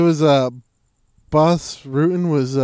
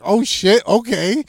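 much higher and louder; loud shocked reaction; 9 semitones higher, 7 dB louder, slower than usual. Retold or imitated. Speaker reacting to the throw tip, loud surprise. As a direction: blurt it out, impressed. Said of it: "And I was like".

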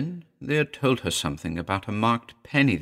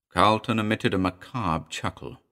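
ordinary delivery

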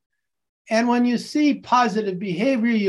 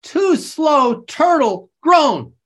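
loud, much higher calls; game call; 7 semitones higher, 5 dB louder, slower than usual. Shown as a demonstration. Teacher calls turtle point to colleagues, loud. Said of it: "And here we go".

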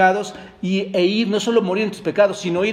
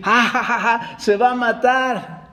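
mocking guffaw; 6 semitones higher than usual. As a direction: jeer with a put-on belly laugh. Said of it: "reírse, carcajearse, hermanos, y decir".

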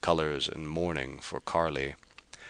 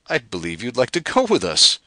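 louder, delighted and impressed; admiring exclamation; 8 semitones higher, 7 dB louder than usual. Acted. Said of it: "he exclaimed admiringly".

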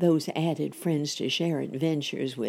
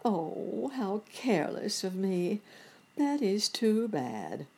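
higher, slowing lament; sad self-reproach; 5 semitones higher than usual. A narrator's reading. Nurse Jane blaming herself for forgetting, sad self-reproach. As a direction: scold yourself ruefully in a higher voice. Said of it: "sadly like".

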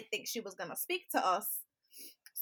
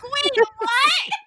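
shocked; 14 semitones higher, 15 dB louder than usual. Retold or imitated. Shocked at brother's confession.

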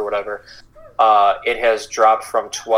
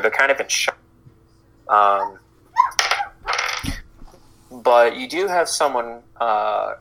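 ordinary delivery

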